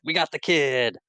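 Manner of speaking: hammed-up triumphant announcement; cheering crowd. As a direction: imitate an excited crowd's cheer. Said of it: "everybody cheers".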